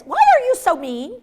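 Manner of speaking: high, loud, drawn-out mock complaint; hammed-up indignant whine; mock hurt. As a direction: protest slowly as if wounded. Acts caller-out being scolded back.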